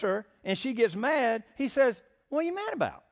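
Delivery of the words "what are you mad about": high-pitched, put-on baffled husband; indignant bafflement. Man acting out his reply to the mad woman, indignant. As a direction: act out a much higher, indignant question.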